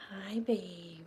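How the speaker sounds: quiet, tender voiced greeting; soft coo. Real, not acted. Softly greeting her purring cat. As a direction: murmur it gently to the pet.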